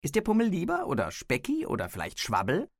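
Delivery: höhere Stimme, spöttisch aufzählend; tönendes Spötteln. A narrator's reading. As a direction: den anderen aus dem Versteck verhöhnen. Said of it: "tönte es aus dem Heuschober". (spöttisch tönend)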